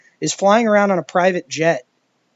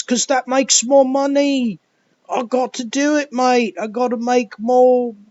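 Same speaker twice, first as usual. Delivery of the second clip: high mock Aussie bloke voice, mate-heavy; mocking Thor's actor; 7 semitones higher than usual. Actor turning to the camera, mock Aussie bloke. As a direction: lampoon the actor as a dim bloke cashing in. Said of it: "the actor who plays Thor turning to the camera and saying".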